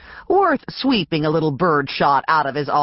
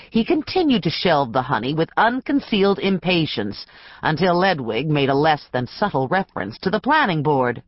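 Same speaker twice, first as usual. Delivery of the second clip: usual speaking voice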